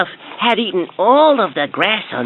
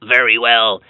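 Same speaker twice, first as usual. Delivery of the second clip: deep troll voice; 7 semitones lower than usual. A narrator's reading. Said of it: "said the Troll".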